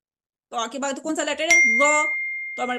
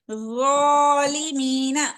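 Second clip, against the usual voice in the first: ज़ालिमीना drawn out very slowly, rising at the end; slow model reading; 4 dB louder, much slower than usual. Shown as a demonstration. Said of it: "concentrate करें". (बहुत धीमे, साफ़ ज़ालिमीना)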